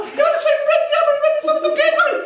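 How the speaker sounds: very high Cork singsong; exaggerated high Cork accent. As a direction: imitate Cork relatives at top pitch. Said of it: "That's how Cork people talk".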